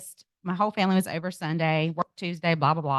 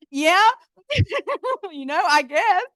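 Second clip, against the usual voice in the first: sheepish laugh; 11 semitones higher, 4 dB louder, slower than usual. Real, not acted. Sheepish reply to her husband.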